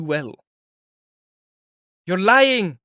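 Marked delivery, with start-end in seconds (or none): 2.0-2.8 a sudden furious shout, high and loud; loud outburst. Acted. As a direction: shout the accusation in his face. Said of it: "he shouted".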